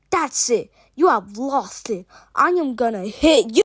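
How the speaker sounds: loud, fed-up outburst; loud acted fury; angry threat. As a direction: raise your voice, building to the blow. Acted quarrel, threatening to hit.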